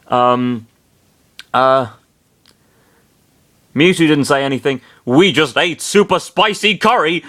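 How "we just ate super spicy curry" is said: raised, higher voice of mock shock; an understated yell of shock; mild shocked yell. A narrator's reading. Chris to the group about the curry, mild shocked yell. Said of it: "Chris yelled in shock".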